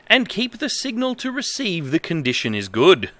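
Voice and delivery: mildly stilted reading of a badly translated manual; manual read-aloud. Streamer reading a bad manual to viewers, lightly formal. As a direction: read it out straight, a touch formal.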